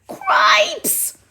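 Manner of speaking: high squeaky mouse yelp; startled squeak of a mouse; squeaky mouse exclaim. Acted. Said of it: "said Furlow".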